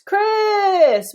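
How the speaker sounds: "criss" with the vowel drawn out, high and loud; vowel stretched long. Shown as a demonstration. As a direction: hold the short i of "criss" much longer. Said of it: "We can make it long".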